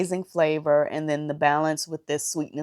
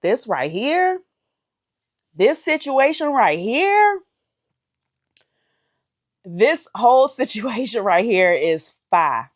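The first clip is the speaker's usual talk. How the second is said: louder and higher with each repeat; building excitement; 6 semitones higher, 8 dB louder than usual. Real, not acted. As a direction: rave harder every time. Raving about the food, building.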